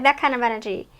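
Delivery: normal talking voice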